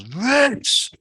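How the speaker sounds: high, loud call, slowed on get up here; a rowdy man shouting across the bar. Retold or imitated. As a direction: summon him up to the table, loud and high. Coach calls him up in restaurant.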